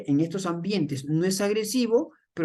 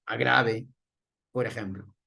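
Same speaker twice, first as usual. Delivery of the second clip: deep voice; 9 semitones lower than usual. Shown as a demonstration. Illustrating announcers' deep manly voice.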